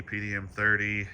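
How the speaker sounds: usual speaking voice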